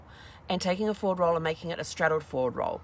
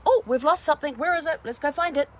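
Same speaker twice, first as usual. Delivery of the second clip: much higher, louder, eager searching voice; voicing the subconscious; 9 semitones higher, 5 dB louder than usual. The subconscious, as the brain, voiced in a much higher, eager tone. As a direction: play the frantic subconscious hunting for something lost. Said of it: "the subconscious goes".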